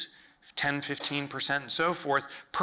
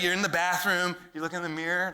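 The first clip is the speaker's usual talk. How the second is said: usual speaking voice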